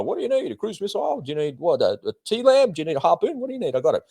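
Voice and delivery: high-pitched mock sales pitch; eager salesman quote. Acts weapon companies offering missiles.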